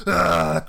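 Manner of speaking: loud imitated vomiting groan; hammed-up retch; mock retching noise. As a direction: act out throwing up. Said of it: "we were chundering all over his desk".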